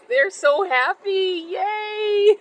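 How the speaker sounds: a bright, high cheer of joy; gleeful cheer. Real, not acted. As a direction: cheer the plants on. Cheering her happy plants.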